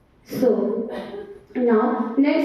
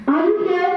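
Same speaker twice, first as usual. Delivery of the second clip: "Are you here?" climbing high at the end; rising intonation; 4 semitones higher than usual. Shown as a demonstration. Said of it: "Our tone rises".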